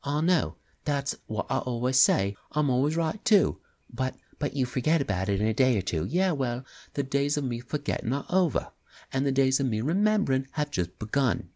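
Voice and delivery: a touch of working-class English; young man, slight English accent. Acted. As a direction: voice the jittery young crook. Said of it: "slight working-class English accent".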